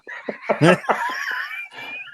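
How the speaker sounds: high, breathy burst of laughter; soft squealing laugh that rises. Real, not acted. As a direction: crack up helplessly at the memory. Retelling the bear story, cracking up.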